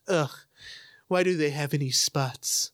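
slow, scornful mock groan; poo-poo them, slowly, as a grumbling critic; imitated disdain. Imitating fans who poo-poo South America, mock disdain. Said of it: "people poo-poo".

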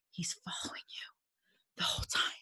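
whispered, hushed and spooky; eerie hushed whisper; creepy whisper. Acted. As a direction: whisper like telling a ghost story. Creepy salesperson described.

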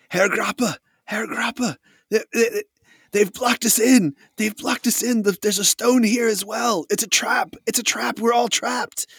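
high-pitched, urgent cries of alarm; panicked soldier. Acted. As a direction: raise the alarm as a trapped, panicking man. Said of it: "screams begin above you".

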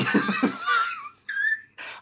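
loud high-pitched cackle; squealing fit of laughter. Real, not acted. Cracks up after saying sorry.

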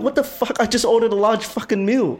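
can't believe what he's hearing; outraged disbelief. Retold or imitated. Outrage at free Coke offer.

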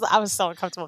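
ordinary delivery

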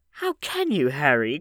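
high, loud protest; crying out. A narrator's reading. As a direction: reproach a friend in a voice that leaps up. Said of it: "cried Darian".

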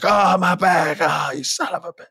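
hamming up hurt back; mock pained wail. Wrestler on the mat crying out over his back, in mock pain. Retelling in-ring injury.

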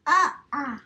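muffled with a spoon in the mouth. Real, not acted. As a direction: sound blocked by a spoon. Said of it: "You got a spoon in your mouth".

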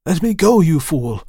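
high, loud desperate cry; urgent outburst, falling at the end; frantic gasp. A narrator's reading. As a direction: struggle to break free. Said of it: "I gasped".

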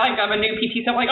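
usual speaking voice

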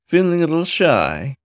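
lilting high teasing question. A narrator's reading. Demon teasing the shy otter, high and playful. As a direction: tease him about his shyness.

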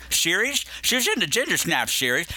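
S sounds turned to SH, "series" as "sheriesh"; slushy slurring. Pretend. Podcast host mocking own slip on the series name, slushy slurring. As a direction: play up a slushy lisp on "series". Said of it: "Why am I talking like this?".

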